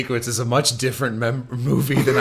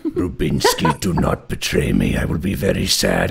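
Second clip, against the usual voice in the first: dopey deep voice; 6 semitones lower than usual. Retold or imitated. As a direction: drop into a deep goofy villain growl. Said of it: "and I'm like".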